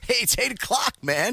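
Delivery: high, pumped-up announcement; hyped-up call. Real, not acted. Host rallying partner to start, hyped up. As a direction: kick things off with excitement.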